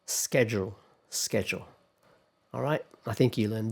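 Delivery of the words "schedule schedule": schedule with a hard "sk" at the start; American pronunciation. Shown as a demonstration. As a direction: say schedule as "SKED-jool". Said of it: "British, schedule".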